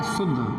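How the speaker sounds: "शुद्ध" said low and firm with a falling tune; emphatic one-word answer. Shown as a demonstration. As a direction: drop the voice and state "शुद्ध" decisively. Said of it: "कैसा करना है?". ("शुद्ध" धीमे गहरे स्वर में ज़ोर से)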